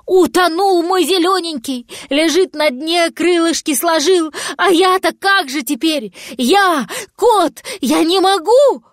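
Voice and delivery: high-pitched wailing monologue; distraught lament. A narrator's reading. Cat mourning his little dragon, high despairing lament.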